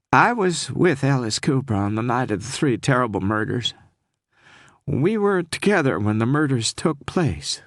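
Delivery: whisper-close and scared, Southern lilt; frightened Southern woman. Acted. Frightened caller confessing to the detective, Southern accent. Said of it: "with a heavy Southern accent".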